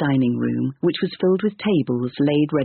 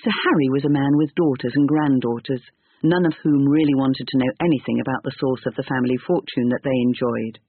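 plain reading voice

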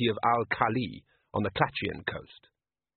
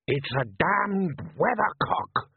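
dismayed growl; 8 semitones higher, much slower than usual. Acted. Father's dismayed answer.

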